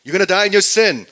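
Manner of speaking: loud, fast and high warning; preacher shouting the consequence; urgent warning. Real, not acted. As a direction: hit them hard with the warning. Preacher hammering home warning.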